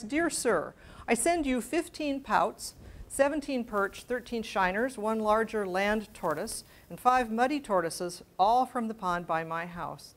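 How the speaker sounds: precise, measured letter reading; letter read aloud. Acted. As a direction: read out the list carefully, like a period letter. Reads Thoreau specimen letter.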